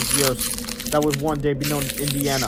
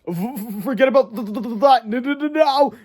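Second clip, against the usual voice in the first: higher, stammering through chattering teeth; shivering stammer; 8 semitones higher, 3 dB louder than usual. A narrator's reading. George shivering while cutting off Ken Pence. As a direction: stutter it out, frozen and frantic. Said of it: "said George, shivering".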